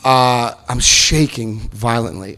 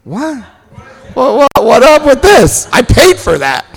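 high, loud mock outrage; hammed-up "what up with this"; indignant disbelief; 13 semitones higher, 8 dB louder, slower than usual. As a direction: play the stunned drinker eyeing a half glass. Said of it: "looked at our mate like".

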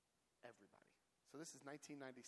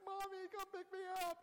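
put-on high, louder childish plea; mock little-kid whine; 6 dB louder than usual. On the phone to his mom, high childish whine. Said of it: "I'm like".

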